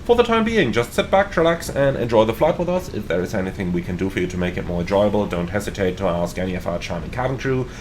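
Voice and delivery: pilot PA announcement; captain announcement. Acted. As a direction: welcome the passengers as captain. Captain welcome announcement to passengers.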